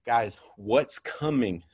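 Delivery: very slow, drawn-out question; slow wondering. Retold or imitated. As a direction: ask it slowly like you can feel it coming. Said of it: "I'm going".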